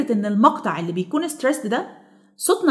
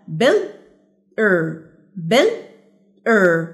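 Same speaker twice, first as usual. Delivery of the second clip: builder slowly split as bil, pause, er; syllable by syllable; 3 semitones lower, much slower than usual. Shown as a demonstration. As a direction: break builder into two separate beats. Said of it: "نشوف كده هنقسمها ازاي". (مقطّعة إلى مقطعين بينهما سكتة)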